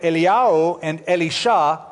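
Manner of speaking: Eliyahu and Elisha as el-ee-YAH-oo, el-ee-SHAH; Hebrew pronunciation. Shown as a demonstration. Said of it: "The Hebrew pronunciation of Elijah is".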